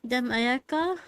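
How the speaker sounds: ordinary delivery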